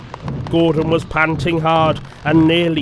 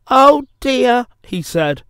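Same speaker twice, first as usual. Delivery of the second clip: high, louder lament; rueful dismay; 8 semitones higher, 5 dB louder than usual. A narrator's reading. Thomas is stuck in the hole, high rueful lament. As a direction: lament it in a small engine's raised voice.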